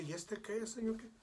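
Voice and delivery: a retold, irritated apostle protesting to the Lord; annoyed Peter. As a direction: play Peter, put out and jealous.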